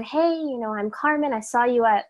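ordinary talk, nothing marked

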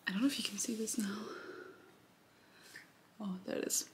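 fast hushed whisper. Real, not acted. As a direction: whisper it quickly. Whispered quick aside.

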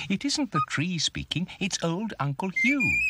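high, chirpy bird voice; chirping bird. A narrator's reading. Birdie explains the owl to Bump, chirping. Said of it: "chirped Birdie".

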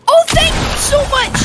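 high burst of relief; real excited relief; overjoyed thanks. Real, not acted. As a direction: gush with sudden gratitude. Player is helped, thanks idol.

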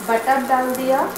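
ordinary delivery

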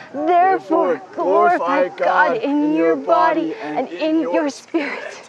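like a hunched man of three hundred; old man voice. Pretend. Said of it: "old man voice".

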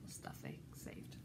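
very soft whisper; hushed little comment; whispered aside. Real, not acted. Hushed aside to young listeners.

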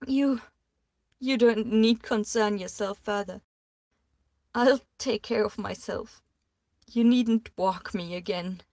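loud, stammering, tearful; dismissing him, stammering through tears; shaky dismissal. A narrator's reading. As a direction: push him away while falling apart.

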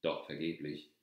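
usual speaking voice

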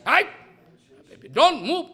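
mock shout. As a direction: order him loudly. Panda shouting at Jagannath.

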